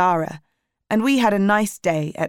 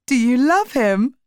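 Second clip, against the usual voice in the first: higher, lively teasing question; teasing laugh; 6 semitones higher, 4 dB louder than usual. A narrator's reading. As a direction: tease with a touch of amusement.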